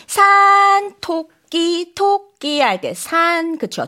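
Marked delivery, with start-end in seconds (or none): 0.1-0.9 산 held high and level as the first tone. Shown as a demonstration. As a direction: hold 산 flat and high like a long line. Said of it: "귀로 들어도 이해할 수 있습니다". (산을 높고 평평하게 길게 끄는 일성)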